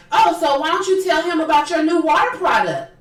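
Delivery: high, loud needling voice; mocking nagging housewife. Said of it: "that's the way she talks".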